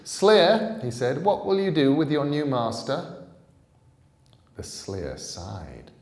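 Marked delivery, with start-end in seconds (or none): 0.0-0.8 calling out higher and loud; high, loud summons; raised voice. A narrator's reading. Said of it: "He raised his voice". 1.2-3.2 calling out higher and loud; high, loud summons; raised voice. A narrator's reading. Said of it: "He raised his voice".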